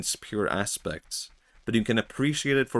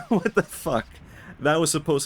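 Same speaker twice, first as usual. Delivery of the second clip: cracking up while playing the hero's outrage; laughing disbelief; 7 semitones higher than usual. The hero finds he slept again, laughing outraged disbelief. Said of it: "and he's like".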